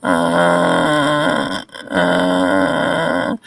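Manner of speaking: low, drawn-out ghostly moan. A narrator's reading. The ghost in the hall moans at Nancy, low and eerie. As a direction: moan deep like a haunting ghost.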